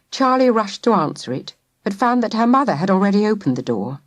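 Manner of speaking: plain reading voice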